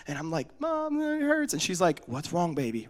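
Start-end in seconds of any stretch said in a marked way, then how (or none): 0.6-1.5 high-pitched whimpering wail; mock hysterical crying. Hurt boy to his mom, mock tearful wail. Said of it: "I'm hysterical". 2.1-2.9 low, soft motherly soothing; real calm. Retold or imitated. Mom to hysterical son, calm and soft. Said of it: "Real calm".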